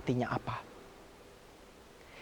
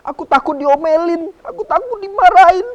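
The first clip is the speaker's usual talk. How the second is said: high, frightened little voice; scared child; 13 semitones higher, 11 dB louder than usual. Acted. The youngest son dreading his father's anger, high and frightened. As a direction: squeak it out like a child afraid of a scolding.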